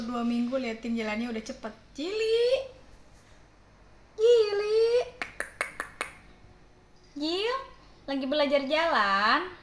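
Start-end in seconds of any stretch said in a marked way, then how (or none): 2.0-4.8 high-pitched call to the kitten; loud high call. Real, not acted. Calling the kitten loudly. 7.2-9.5 high, loud cooing at a kitten; high sing-song pet voice; cooing pet talk. Real, not acted. Cooing at the kitten learning to walk.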